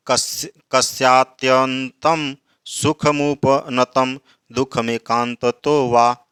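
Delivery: slow, measured Sanskrit verse chanting; verse recitation. Acted. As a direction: intone each word of the shloka slowly. (धीमे, लयबद्ध श्लोक पाठ)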